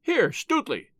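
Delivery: high-pitched call to a friend; quick hail to a comrade; crying out. A narrator's reading. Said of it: "he cried".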